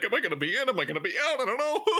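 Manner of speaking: high, laughing mock worry. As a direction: play the nervous manager, giggling. Voicing worried manager, laughing.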